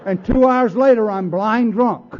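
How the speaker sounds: laughing as he shouts it; loud punchline. Real, not acted. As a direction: belt the punchline, drawing it out. Punchline after drunk story.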